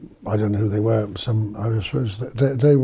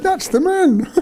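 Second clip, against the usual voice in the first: very high exclamation of discovery; retold excited recognition; 4 dB louder, slower than usual. Girl spots the man's picture on the wall, retold excited recognition. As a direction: cry out as if spotting him suddenly.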